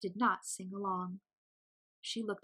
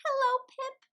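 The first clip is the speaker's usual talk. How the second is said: much higher and louder, gushing hello; 6 dB louder than usual. A narrator's reading. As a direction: gush with eager delight. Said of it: "Mary gushed".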